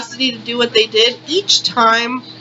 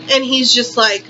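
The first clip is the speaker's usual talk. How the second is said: ordinary delivery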